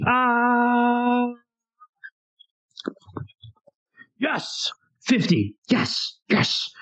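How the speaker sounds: high tense wish, then a cry and soft cheers; nervous then elated. Real, not acted. Player making a dex roll, tense then cheering. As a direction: hold breath, then celebrate the roll.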